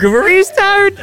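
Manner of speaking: hammed-up panicky plea; mock plea. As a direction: comically beg them not to come. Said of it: "I'm like".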